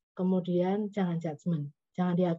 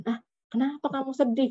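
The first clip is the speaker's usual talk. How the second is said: high, dismissive scoff at someone's sadness; judgmental; 7 semitones higher than usual. Retold or imitated. Dismissive friend, high mock scolding of a sad person. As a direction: brush off their sadness as silly. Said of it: "contoh sikap menghakimi".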